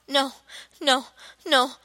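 usual speaking voice

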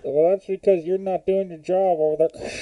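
into a cup. Pretend. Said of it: "you're screaming into that glass".

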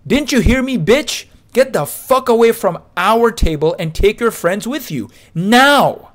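high, loud furious scream. Retold or imitated. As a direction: scream it at her. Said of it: "and screams".

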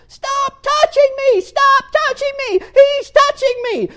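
high-pitched full-volume scream; shrieked tantrum, very loud and high; screaming at top of lungs. Retold or imitated. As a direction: scream it like a child in a meltdown. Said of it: "started yelling and screaming at the top of his lungs".

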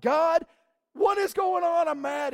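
pouring out anger in a loud, pleading prayer; angry prayer. Retold or imitated. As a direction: yell your frustration straight at God. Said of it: "if we go".

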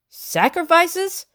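slow, drawn-out outraged question; indignant echo. A narrator's reading. Lockton echoing Bellingham in outraged disbelief. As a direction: repeat the word in shocked disbelief. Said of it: "Master Lockton asked".

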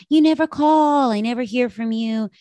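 high-pitched whiny-friend imitation; clingy friend. Clingy friend opening a call with a complaint, mock needy. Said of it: "that super clingy friend".

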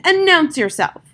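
loud, demanding call; commanding shout. A narrator's reading. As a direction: order the stranger to speak up. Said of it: "shouted the magician".

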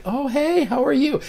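very high, caught-off-guard greeting; surprised hello. Retold or imitated. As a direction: squeak it out, startled but friendly. His startled reply to the student.

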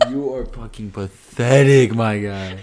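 slow, loud mock through laughter; laughing taunt. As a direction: laugh while jeering at him, drawn out. Mocks the kid while laughing.

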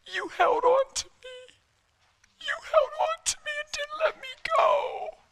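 very high, squeaky tearful wail; imitating the wife sobbing out her words; crying. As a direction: wail it through tears, far up high. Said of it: "just started crying".

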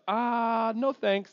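high, drawn-out reluctant refusal; polite brush-off. Acted. Church people declining to help, politely dismissive. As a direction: back away from it in a lifted voice.

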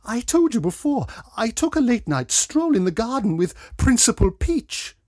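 high, loud female character voice; protesting Miss Redman. A narrator's reading.

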